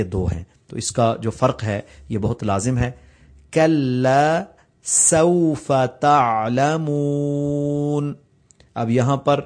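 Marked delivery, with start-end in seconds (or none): 3.5-8.1 کلا سوف تعلمون recited slowly with a clear k and a whistling س; slow recitation. Shown as a demonstration. Teacher reciting the verse correctly for students. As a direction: stretch it out melodically. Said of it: "کلا کو قلا پڑھ دیتے ہیں".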